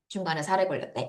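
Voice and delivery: low, quiet voice after something went down the wrong way; real choked-up throat, speaking softly; throat caught mid-sentence. Real, not acted. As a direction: recover from a catch in the throat. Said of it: "살에 걸렸네".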